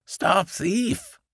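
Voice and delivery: a high-pitched cry of stop thief; angry gardener calling after a thief. A narrator's reading. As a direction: shout after the rabbit, voice jumped up high. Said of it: "waving a rake and calling out".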